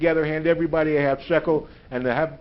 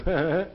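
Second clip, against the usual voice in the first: imitated short nervous chuckle. Young rabbi prodding the speaker about the text, imitated nervous chuckle. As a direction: do a little uneasy heh-heh. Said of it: "He gives his nervous chuckle".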